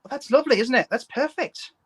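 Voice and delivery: high, hammed-up British praise; mock British accent. Guest praises co-host's accent, in a mock British accent. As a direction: approve in a lilting, high British voice. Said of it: "I especially love doing British accents".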